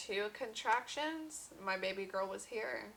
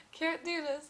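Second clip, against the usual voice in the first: mock scream; 9 semitones higher, 5 dB louder than usual.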